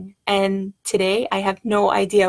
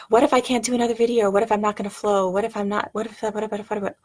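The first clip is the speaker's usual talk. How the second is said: fast spiral of doubts, fading to a mumble; quickening, trailing-off mock worry; worried what-ifs; faster than usual. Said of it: "what ifs came".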